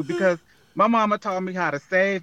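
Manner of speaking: usual speaking voice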